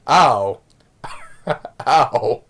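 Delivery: computer-voice ow, amused; Stephen Hawking. Retold or imitated. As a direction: imitate the speech computer, with a chuckle. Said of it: "it's just the way the voice says it".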